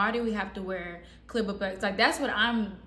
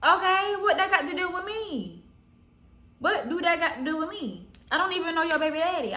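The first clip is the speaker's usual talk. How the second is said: indignant; 8 semitones higher than usual. Retold or imitated. Indignant reply to jealous girl.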